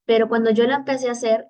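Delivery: normal talking voice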